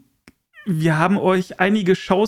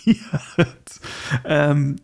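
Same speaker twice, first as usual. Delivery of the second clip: ordinary talk, nothing marked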